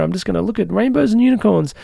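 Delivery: lightly voiced naive optimist; a hint of breezy Pollyanna cheer; mock Pollyanna. Mocking someone who ignores offense, lightly voiced Pollyanna. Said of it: "it's like Pollyanna".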